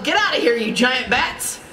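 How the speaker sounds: mild storybook yell, no real volume; mock yell. A narrator's reading. Donald orders the bats out, mock yelling. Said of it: "yelled Donald".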